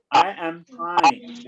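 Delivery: "I am Pine" slowly, every word clear; slow model sentence. Shown as a demonstration. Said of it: "我是 [name]".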